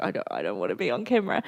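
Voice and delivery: mock the shy husband quietly; imitating husband. Husband voiced refusing the camera.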